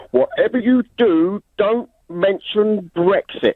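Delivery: high, drawn-out mock warning; retold Fawlty Towers-style caution; auntie's warning. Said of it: "my auntie said to me".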